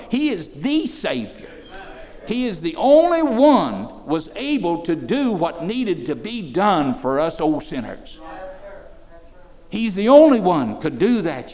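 loud, ringing pulpit proclamation; preaching at full force. Acted. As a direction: preach it out to the room. Preaching about the Savior.

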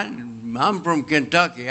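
ordinary delivery

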